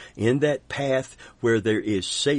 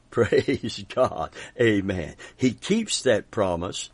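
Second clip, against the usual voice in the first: ordinary delivery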